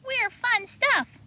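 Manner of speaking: high pleading puppet voice; dinosaur puppet voice. Pretend. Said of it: "Emerson made her dinosaur puppet say".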